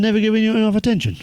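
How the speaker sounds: high, quick indignant question; acted outrage, pitched right up; incredulous echo. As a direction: throw the complaint back in disbelief.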